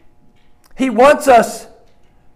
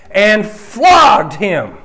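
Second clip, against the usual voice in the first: FLOGGED punched out loud and drawn out; slow down and hit "flogged" hard; "flogged" stretched and raised as a heavy hint; emphasis on flogged; 3 semitones higher, 5 dB louder, much slower than usual. Real, not acted. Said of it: "emphasis on the word flogged".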